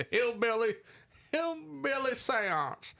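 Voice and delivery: high, drawn-out hillbilly twang; slow, high-pitched mock hillbilly; bumpkin accent. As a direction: ham up a backwoods bumpkin accent. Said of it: "sound like a fucking bumpkin".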